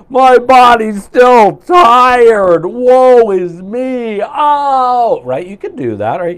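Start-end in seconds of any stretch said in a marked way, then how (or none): 0.1-5.2 very slow, loud, high woeful wail; mock melodramatic lament; hammed-up moaning. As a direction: overact misery over a tired body. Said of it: "you could wake up and go".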